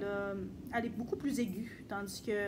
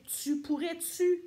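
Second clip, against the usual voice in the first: put-on touche d'accent québécois, lent; Québécois accent; 5 semitones higher, 5 dB louder than usual. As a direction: imite un Québécois qui demande. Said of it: "des Québécois peuvent dire". (accent québécois léger)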